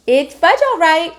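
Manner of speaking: put-on a small boy parroting his brother; little Fudge echoing. A narrator's reading. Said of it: "my brother repeated".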